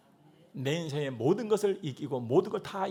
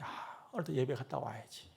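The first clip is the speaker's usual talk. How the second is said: quiet self-encouragement; 7 semitones lower, 7 dB quieter than usual. Retold or imitated. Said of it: "내가 내 자신을 격려하고".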